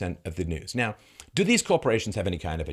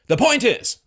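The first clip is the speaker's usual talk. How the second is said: loud, high interruption; exaggerated shouted deflection; mock blustering pivot; 10 dB louder than usual. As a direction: bluster over the question, mimicking an evader. Mimics reporter pivoting away.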